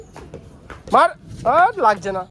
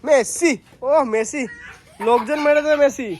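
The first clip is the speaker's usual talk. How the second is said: teasing; 8 semitones higher, 4 dB louder, slower than usual. Real, not acted. Teasing the kid.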